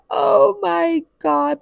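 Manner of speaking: high, loud, drawn-out dismay; mock horror. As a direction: relive the mortified shock, stretching each word. Reliving her horror at the smell.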